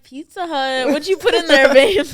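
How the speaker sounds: loud mock complaint. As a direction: call out playfully. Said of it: "I'm like".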